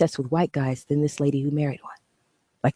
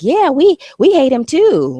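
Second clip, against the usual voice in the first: much higher, louder mock agreeable voice; mocking the haters; 11 semitones higher, 7 dB louder than usual. Mocking commenters who ride her coattails, high mock voice. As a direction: parody fake allies, squeaky and eager. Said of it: "trying to act like".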